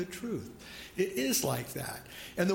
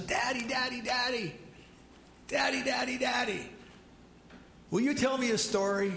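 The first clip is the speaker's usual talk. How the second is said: put-on high begging little-kid voice; pleading toddler; 5 semitones higher than usual. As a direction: nag like a two-year-old. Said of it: "little Joey looks at him and says".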